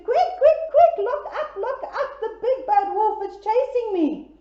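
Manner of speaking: high, loud panicked squeal; frantic piggy. Acted. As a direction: squeal it in a panic, half laughing. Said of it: "he said".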